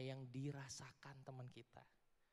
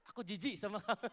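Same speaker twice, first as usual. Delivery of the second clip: louder, mock disgusted sneer; 4 semitones higher, 9 dB louder than usual. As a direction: act out the disgust, playfully. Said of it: "kayak ngadep ke gua". (mengejek dengan jijik, keras)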